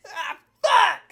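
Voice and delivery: loud high yelp of pain, hammed-up. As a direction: yell it like you just stubbed your toe. Toe stubbing retold, yelled.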